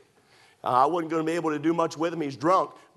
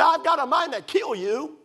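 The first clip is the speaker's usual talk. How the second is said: threat; 11 semitones higher than usual. Retold or imitated. Drunk father threatens.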